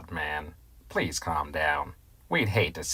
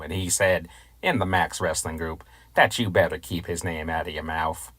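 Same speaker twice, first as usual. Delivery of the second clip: ordinary talk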